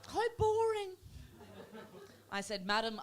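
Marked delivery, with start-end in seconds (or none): 0.1-1.0 high, louder lady voice; let-down dentist lady. Acted. Dentist lady judging English people, high disappointed voice. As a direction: pronounce it in a high put-on voice. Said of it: "She said".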